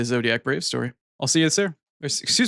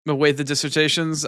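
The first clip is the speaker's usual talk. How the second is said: ordinary talk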